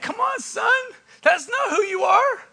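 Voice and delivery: high, softened fatherly plea; acted dad, voice lifted in tender appeal; pleading dad. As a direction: gently implore your son. Dad pleading with dutiful son.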